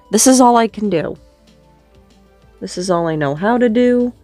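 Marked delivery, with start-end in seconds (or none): none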